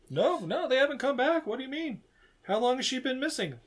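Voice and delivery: high startled agent voice; alarmed agent. Retold or imitated. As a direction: sound thrown and alarmed. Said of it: "he's like".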